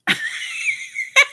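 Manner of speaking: high shrieking burst of laughter. Real, not acted. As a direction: let out a wild squealing laugh. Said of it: "I started laughing".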